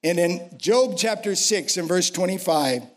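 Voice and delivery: ordinary talk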